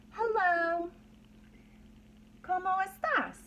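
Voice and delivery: bright lifted greeting, Hello then ¿Cómo estás? in Spanish; greetings in many languages. Shown as a demonstration. Teacher greets the children in different languages, lifted and cheerful. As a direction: greet the class warmly in Spanish. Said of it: "these different languages you heard me say".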